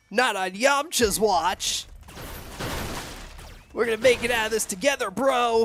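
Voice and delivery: laid-back surfer bro, lightly voiced; dude bro surfer voice. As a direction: hype up your buddy like a chill surfer. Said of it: "the dude bro surfer voice".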